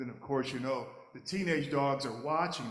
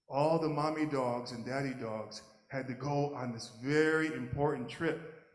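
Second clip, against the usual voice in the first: ordinary delivery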